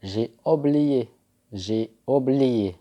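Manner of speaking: slow, careful French phrase; teacher modelling a phrase slowly; slow model phrase. Shown as a demonstration. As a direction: stretch it out slowly to be copied. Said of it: "وعبارة".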